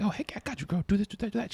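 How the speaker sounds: high, soft, eager coaching voice; upbeat, chirpy dance tips; eager dance teacher. Retold or imitated. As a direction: play the keen helper, light and up. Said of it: "I'm like".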